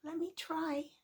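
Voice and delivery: small, high, soft voice; eager little mouse. Acted. Little mouse to doubting lion, softly offering help.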